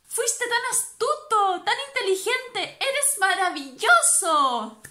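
high, eager, slowed little voice; excited praise. Acted. Little toad praising his clever grandpa, high and excited. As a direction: gush with admiration.

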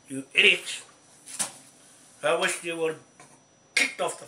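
loud, furious yell of contempt; angry shout. Real, not acted. Ranting at officials, a loud furious shout. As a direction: bark it at them like an insult.

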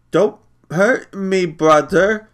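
put-on high, slow, scared child plea; frightened warning. A narrator's reading. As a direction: warn him off in fear. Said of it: "he warned".

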